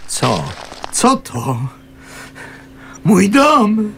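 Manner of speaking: see your home in flames; stunned outcry; horrified cry. Acted. Sees his shed burning.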